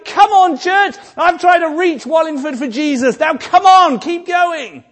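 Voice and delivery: high, loud cheering encouragement; Bernard shouting. Pretend. Bernard calling from heaven to his church, a loud, high cheer. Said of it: "Bernard is shouting".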